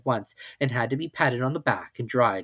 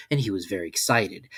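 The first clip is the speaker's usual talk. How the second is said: ordinary delivery, nothing marked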